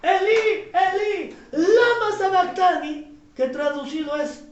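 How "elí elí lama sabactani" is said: raised, drawn-out cry from the cross; louder, slowed biblical cry; cried-out quotation. Acted. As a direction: call it out to God, stretching the words. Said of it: "dijo estas palabras".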